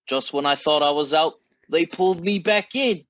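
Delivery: hammed-up Godfather accent, pitch climbing toward the end; terrible Al Pacino accent. Angry Washington fan quoting The Godfather, in a terrible Pacino accent. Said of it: "that was a terrible accent right there".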